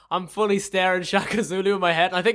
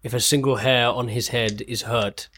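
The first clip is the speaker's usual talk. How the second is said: stern warning; 10 semitones lower than usual. Acted. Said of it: "look him in the eye and say".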